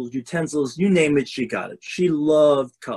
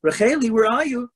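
higher, louder call up the house; 9 semitones higher, 3 dB louder than usual. Acted. Mother calls her daughter coming in, high call.

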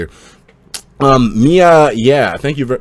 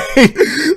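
sharp laugh; 13 semitones higher, 4 dB louder than usual. Real, not acted. Laughs at his own joke.

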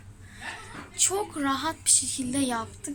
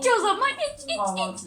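teasing squeal; 11 semitones higher than usual. Real, not acted. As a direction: taunt them to drink it up. Teasing the other to drink.

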